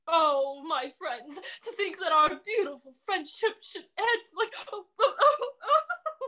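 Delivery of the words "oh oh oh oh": rising high wailing oh. Acted. Dragon wails as the friendship ends, rising high. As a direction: wail higher each time.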